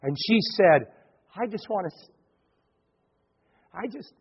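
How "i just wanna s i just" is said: softer, halting, words breaking off; a mild imitation of tearful stammering; choked up. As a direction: retell her choking on the words. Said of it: "and tears started coming down her face".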